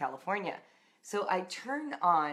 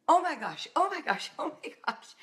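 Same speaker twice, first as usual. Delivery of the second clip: panicked gasping; 10 semitones higher than usual. Retold or imitated. Crashed into fire truck.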